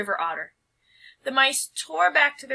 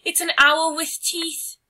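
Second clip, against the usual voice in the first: higher, louder panicked cry; frightened cry; 8 semitones higher, 6 dB louder than usual. Acted. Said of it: "she cried".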